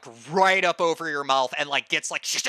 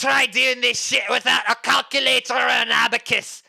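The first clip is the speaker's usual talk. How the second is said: higher, louder mocking drunk voice; scornful barkeep; 5 semitones higher, 6 dB louder than usual. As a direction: sneer at the city folk's gadgets. Bartender mocking calculator.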